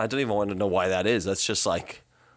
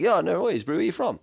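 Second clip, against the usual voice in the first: higher, excited South African chat; South African accent; 10 semitones higher than usual. Retold or imitated. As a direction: greet a fellow South African in full accent. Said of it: "to just sounding full blown South African".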